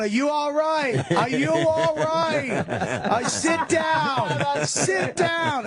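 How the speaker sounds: high, slow mock concern; worried bystander. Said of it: "And someone's going".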